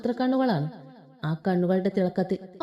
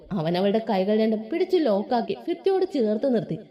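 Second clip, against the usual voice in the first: usual speaking voice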